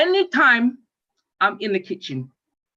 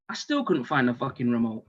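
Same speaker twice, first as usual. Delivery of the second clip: ordinary talk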